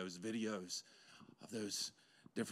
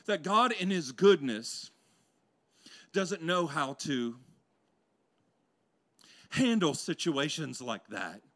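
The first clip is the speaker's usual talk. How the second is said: preaching; 5 semitones higher, 8 dB louder than usual. Acted. Preacher telling the church how they picture God, loud. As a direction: lean into each phrase.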